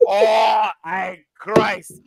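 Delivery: hammed-up Scottish accent, high mock wail; Luke, Yorkshire accent turned Scottish. Friend carrying on Luke's impression, mock-anguished Scottish accent. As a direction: cry out in a badly faked Yorkshire accent. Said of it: "here's Luke's impression of it".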